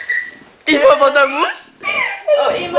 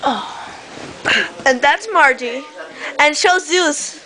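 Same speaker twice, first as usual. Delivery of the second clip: normal talking voice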